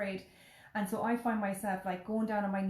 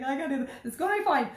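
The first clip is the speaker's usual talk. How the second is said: put-on kid panicking; 5 semitones higher, 5 dB louder, faster than usual. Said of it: "He's like".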